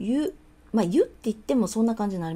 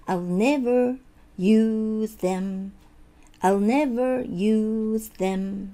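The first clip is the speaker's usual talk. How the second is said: slow model, "never" strong and raised; stress on NEVER; 2 semitones higher, much slower than usual. Shown as a demonstration. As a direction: punch "never", then draw out "use them". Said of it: "never強いですね". (「never」を強くゆっくり)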